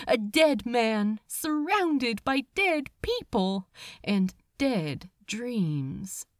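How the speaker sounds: ordinary delivery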